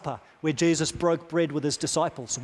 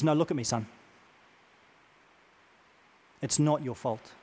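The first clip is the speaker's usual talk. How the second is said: softer, lower, tender counsellor's voice; a hint of Robin Williams' therapist, softer and tender; gentle reassurance; 4 semitones lower, 5 dB quieter than usual. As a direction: lean in and reassure the boy softly. Therapist gently repeats to young man.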